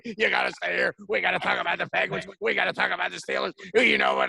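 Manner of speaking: a hint of a rowdy Pittsburgh talker, fast at the end; buddy from Pittsburgh begging a guest to stay, mock brash; Scott Farrell impression. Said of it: "Good impression".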